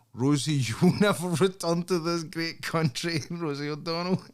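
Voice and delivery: high, laughing taunt; gleeful mock Trump gloat; laughing mock jab.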